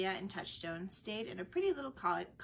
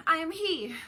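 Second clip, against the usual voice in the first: eager admission; 9 semitones higher, 10 dB louder than usual. A narrator's reading. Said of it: "Orlando admitted".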